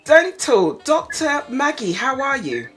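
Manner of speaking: ordinary talk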